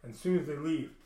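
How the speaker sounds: plain reading voice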